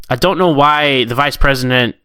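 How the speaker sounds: ordinary talk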